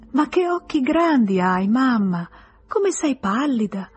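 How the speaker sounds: put-on childlike, slowly drawn-out exclamations; little child. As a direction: marvel like a small child at mamma. Small child marvels at sick mother.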